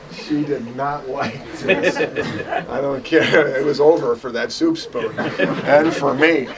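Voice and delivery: slow, laughing while talking; amused, still chuckling at the spoon; laughing through words. Real, not acted. Laughing at childhood memory.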